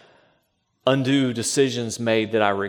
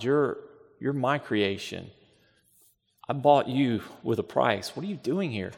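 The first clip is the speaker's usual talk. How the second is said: soft, hushed and tender; much softer, tender divine voice; gentle God voice; 4 dB quieter than usual. Acted. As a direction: ask it softly, like a father to a child. Voicing God's gentle question to Elijah.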